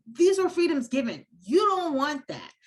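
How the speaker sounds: scornful paraphrase. Retold or imitated. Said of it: "He's like".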